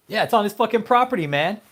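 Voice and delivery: loud, high, furious yell; angry shout. Real, not acted. Ranting at David about his private lake, furious shout. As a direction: blast it out, outraged.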